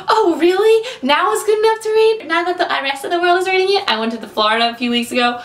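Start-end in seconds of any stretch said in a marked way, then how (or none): 0.1-2.3 high, loud mocking sarcasm; sarcastic retort. She mocks late fans who only read it for the movie, high sarcastic jab. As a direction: throw their words back at them. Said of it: "her sarcastic retort".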